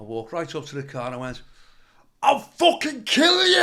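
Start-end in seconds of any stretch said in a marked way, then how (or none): 2.2-3.6 a threatening roar, much higher and louder; furious scream. Retold or imitated. Threatening a stranger by his car, furious scream. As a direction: scream it at him full force, slow and high. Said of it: "And I screamed at him".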